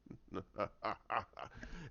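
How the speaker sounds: low, soft evil laugh; villain chuckle. Acted. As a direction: chuckle low and quietly in triumph.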